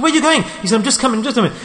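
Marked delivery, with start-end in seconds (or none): 0.0-0.4 high-pitched mock questioning; wife questioning husband. 0.7-1.6 husband's hasty excuse. Retold or imitated. Husband putting off his wife.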